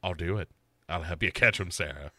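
very low, soft killer voice; imitating serial killer. Killer agreeing to help Sarah, very low quiet voice. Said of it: "And he's like".

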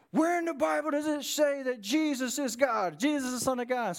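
high-pitched imitation of an irate caller; retold angry yelling. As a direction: demand it like a yelling caller, without full volume. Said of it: "why are you yelling".

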